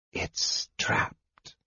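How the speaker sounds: soft voiceless whisper; hushed whisper of dread. A narrator's reading. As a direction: breathe the warning. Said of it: "Raistlin whispered".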